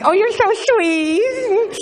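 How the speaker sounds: put-on very high, drawn-out girly squeal; swooning girlfriend. Girlfriend swooning at her boyfriend's sweet talk, high mock gushing. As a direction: gush loudly in a falsetto girl voice.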